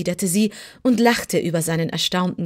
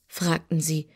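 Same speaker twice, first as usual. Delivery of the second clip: ordinary delivery, nothing marked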